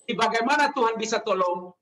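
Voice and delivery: ordinary delivery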